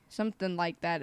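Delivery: ordinary delivery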